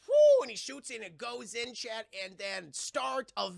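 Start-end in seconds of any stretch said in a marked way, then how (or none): 0.1-0.4 very high, loud excited whoop. Retold or imitated. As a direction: let out a joyful whoop. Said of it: "and he goes".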